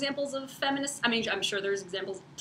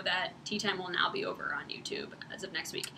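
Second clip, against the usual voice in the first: whisper; 4 semitones lower, 9 dB quieter than usual. Real, not acted. Aside while announcing move.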